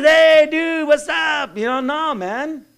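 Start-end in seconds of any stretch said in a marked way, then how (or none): 0.0-1.6 mock guy-talk greeting. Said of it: "I'm not one of the guys".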